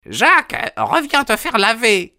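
a mother shouting after her runaway son; mother calling out. A narrator's reading. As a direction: call the boy back from across the yard. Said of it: "cria-t-elle".

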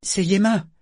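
voix de garçon beaucoup plus aiguë, affolée; un jeune mousse paniqué derrière la porte; garçon désespéré. A narrator's reading. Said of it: "sur un ton désespéré". (le mousse Yémin annonce la mort au capitaine, désespéré)

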